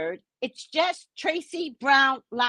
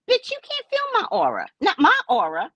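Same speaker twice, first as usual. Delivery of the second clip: sassy retort; 11 semitones higher than usual. Retold or imitated. Retort to fan.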